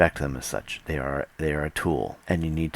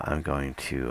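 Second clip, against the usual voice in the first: ordinary delivery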